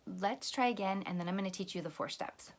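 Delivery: ordinary delivery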